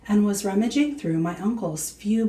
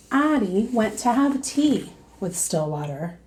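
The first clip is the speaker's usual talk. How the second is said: plain reading voice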